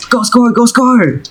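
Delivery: high-pitched urging from a playmate; excited kid. Acted. Kid passes Dexter the ball, excited urging. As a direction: cheer a teammate on in a high kid voice.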